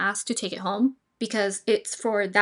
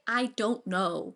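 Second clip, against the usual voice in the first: slow honest shrug; 2 semitones lower, 4 dB quieter, much slower than usual. Shown as a demonstration. Adviser modelling an honest, unhurried answer about food. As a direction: say it slowly, no judgment. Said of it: "you can just say".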